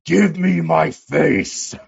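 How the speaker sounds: loud, drawn-out anguished demand; hammed-up comic-book vigilante yell; Rorschach. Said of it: "like in, what's The Watchmen, Rorschach?".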